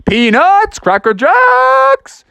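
high, loud, stretched-out hawking; full-throated vendor cry. Acted. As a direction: belt the sales call across the upper deck. Froggy hawks at full tilt.

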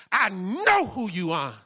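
a furious relative shouting the pastor down; angry shout. Retold or imitated. Angry brother-in-law confronts pastor.

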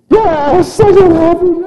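put-on loud, very high child's wail; hammed-up squealing kid protest; Chloe. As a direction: imitate a little girl bawling her answer. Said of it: "and she's crying".

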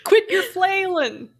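high, loud, drawn-out mock scolding; hammed-up film quote. Mocking Winslow's fall off the rope, hammed-up. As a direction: play it big and slow.

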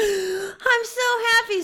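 high, chirpy small creature; little rabbit voice. Acted. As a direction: play a sweet murder bunny. Biscuit voiced happy.

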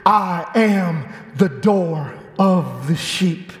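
very slow, low and measured; drawn-out, deep reading of holy words; solemn scripture reading. Real, not acted. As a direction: read the verse weightily, word by word. Reading a scripture verse aloud.

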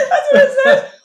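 high loud laughter through words; real laughter while talking; laughing. Real, not acted. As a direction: laugh out the line. Laughing with friend.

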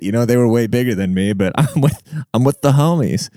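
ordinary delivery

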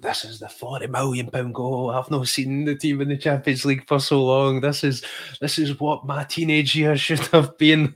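reliving the thrill of the goal; hyped-up fan yelling at the telly; excited fan rant. Retold or imitated. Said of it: "saying all these things like".

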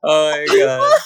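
breathless from laughing; after laughing hard. Real, not acted. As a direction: still laughing, high. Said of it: "I'm crying laughing".

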